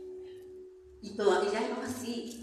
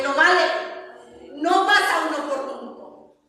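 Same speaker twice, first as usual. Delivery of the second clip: louder, higher preacher voice; full-force preaching; 6 semitones higher, 7 dB louder than usual. Acted. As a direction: proclaim it to the congregation.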